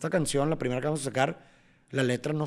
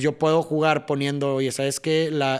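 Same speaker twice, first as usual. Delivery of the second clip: ordinary talk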